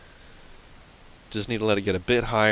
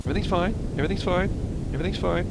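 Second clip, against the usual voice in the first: mock reassurance; 9 semitones higher, faster than usual. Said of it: "repeats reassurance during disaster".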